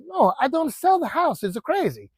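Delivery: high-pitched mock protest; indignant Italian homeowner. Homeowner turning down a written offer, mock indignant. Acted haggling, owner refuses.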